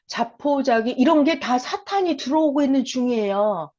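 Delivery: ordinary talk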